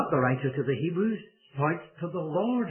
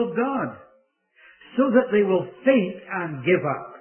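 higher, insistent preacher's warning in measured phrases; urgent preaching; 4 semitones higher, 4 dB louder than usual. Real, not acted. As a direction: drive each phrase home, pausing between them.

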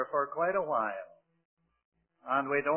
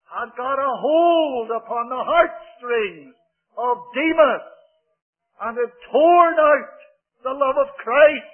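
loud, high denunciation; fiery pulpit condemnation; loud preaching; 5 semitones higher, 10 dB louder than usual. Real, not acted. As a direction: hammer each word home.

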